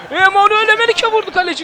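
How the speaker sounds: rising commentator shout on the shot; excited call. Real, not acted. Gamer calling his striker's shot, excited. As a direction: call the shot with a jump in pitch.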